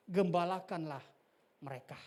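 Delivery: normal talking voice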